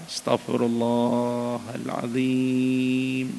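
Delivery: very slow, drawn-out prayer; slow, devout chant of repentance; slow istighfar. Real, not acted. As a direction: stretch every syllable in devotion. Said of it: "Kita istighfar".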